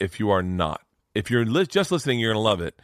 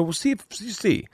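coaxing; 11 semitones higher than usual. Retold or imitated. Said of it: "I go".

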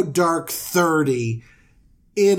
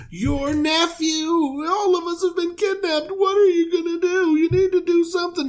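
frantic shout; 14 semitones higher than usual. Acted. Messenger bursting in with news.

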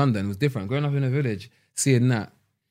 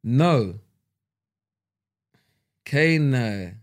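usual speaking voice

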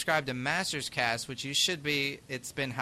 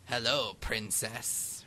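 half-hearted attempt at a gruff cartoon villain; Hordak; 2 semitones lower, 3 dB quieter, slower than usual. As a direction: try a gruff villain greeting, not quite getting there. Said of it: "I can't do Hordak's voice. It's a bit gruff".

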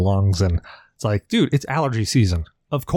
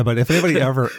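ordinary talk, nothing marked

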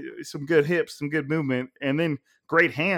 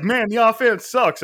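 loud, high mock fan griping; complaining fans; 7 semitones higher, 7 dB louder than usual. Imitating fans at training camp, loud complaint. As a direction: gripe loudly like a let-down fan. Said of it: "they're always just like".

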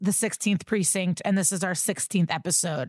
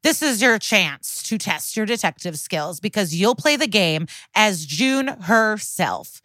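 punchy, louder promo voice; louder ad read; 5 dB louder than usual. Acted. Host selling a mystery game to listeners, loud promo read. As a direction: pitch the game with dramatic flair.

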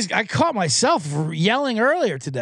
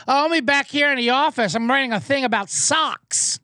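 mock Andy Rooney; 7 semitones higher than usual.

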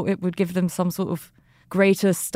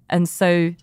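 ordinary talk, nothing marked

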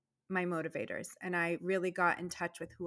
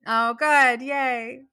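loud, raised-pitch happy cheer; delighted relief; 5 semitones higher, 12 dB louder, much slower than usual. Real, not acted. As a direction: cheer out your relief at the praise. Relieved at being told she explained well.

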